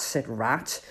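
usual speaking voice

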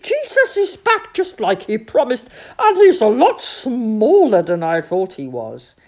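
high, loud, excited shouting. A narrator's reading. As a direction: shout the news through the woods.

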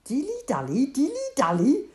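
loud, high, mocking repeat; furious shout. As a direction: throw the word back in a rage. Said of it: "shouted Joram furiously".

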